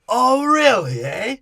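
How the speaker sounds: high, loud put-on pirate voice; mock Scrum voice. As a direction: ham up a gullible pirate. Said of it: "Scrum's like".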